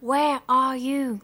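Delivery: slow, drawn-out question; hesitant slow call to someone unseen; careful beginner English. A narrator's reading. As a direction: feel out each English word slowly. French girl trying English.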